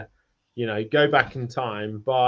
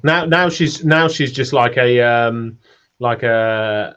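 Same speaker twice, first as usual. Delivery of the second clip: usual speaking voice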